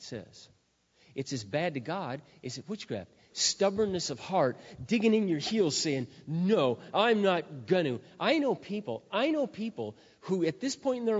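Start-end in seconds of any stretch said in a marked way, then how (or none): 3.3-6.1 loud, slow, emphatic pulpit voice; preacher hammering home a warning to the church, preaching at full force; fiery preaching. Acted. Pastor warning the congregation against stubbornness, fiery preaching. 6.3-8.0 stubborn refusal. Retold or imitated.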